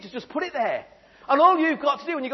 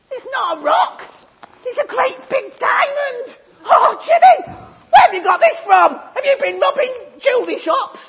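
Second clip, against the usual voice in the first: much higher, loud, laughing squeals; amazed mum; 13 semitones higher, 11 dB louder than usual. Acted. Mum finding a diamond in Jimmy's bag, shrill and excited. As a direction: gush over the diamond with shrieks of delight.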